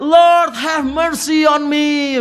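slow, high, drawn-out cry for mercy; hammed-up plea shouted up high; mock shout for mercy. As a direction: shout it slowly like a desperate prayer. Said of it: "Apakah Yesaya berteriak".

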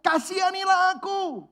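high, slow pleading cry; a beggar crying out for mercy, loud and high; desperate cry. Retold or imitated. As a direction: beg for mercy at the top of your voice. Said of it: "Dia teriak, teriak, teriak".

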